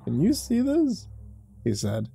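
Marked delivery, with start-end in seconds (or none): 0.0-1.1 put-on much higher, softer amazed drunk; a wide-eyed drunk gawking; tipsy drunk.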